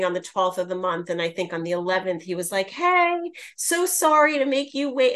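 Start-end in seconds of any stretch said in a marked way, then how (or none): 2.7-5.1 much higher, sweet and apologetic; high, gentle mock apology; sweet apologetic Tom. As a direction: play him as a sweetly sorry friend. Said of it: "he's so sweet in his texts".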